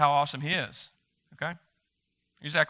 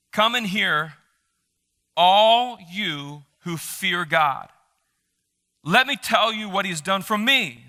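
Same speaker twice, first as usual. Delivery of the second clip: raised, solemn psalm reading; scripture reading; 3 semitones higher, 4 dB louder, slower than usual. Acted. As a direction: proclaim the verses to the congregation. Reads psalm aloud.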